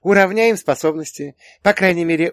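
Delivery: put-on high, loud, pleased girl; sweetly teasing. A narrator's reading. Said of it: "сладко улыбнулась Юля". (сладко поддразнивая, высоким голосом)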